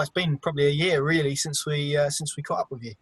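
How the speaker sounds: ordinary talk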